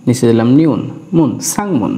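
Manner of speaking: ordinary delivery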